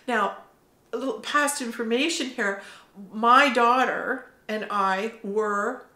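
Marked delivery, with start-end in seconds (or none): none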